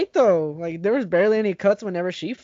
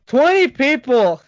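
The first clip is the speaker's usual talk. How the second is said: incredulous shout; 7 semitones higher, 7 dB louder, slower than usual. Real, not acted. Incredulous outburst at friend.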